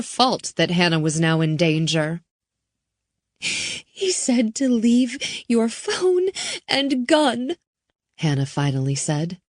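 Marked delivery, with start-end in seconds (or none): 3.4-7.6 put-on higher, slow frightened girl; scared girl. Girl relaying the demand, higher and slow. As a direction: repeat the order haltingly. Hannah's voice.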